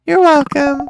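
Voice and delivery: normal talking voice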